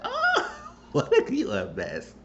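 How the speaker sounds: high, slow words broken by laughter; laughing in disbelief. Real, not acted. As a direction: laugh it out, scandalized. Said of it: "I was like".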